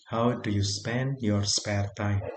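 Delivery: very slow model phrase. Shown as a demonstration.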